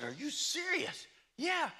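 high, shouted disbelief; astonished. Retold or imitated. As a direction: yelp it out in disbelief. Hearing truck is nearby, astonished.

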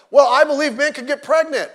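loud, high-pitched mock declaration; mocking quote. Pastor parroting people he finds ridiculous, mocking. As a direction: parrot it loudly and high, in mockery.

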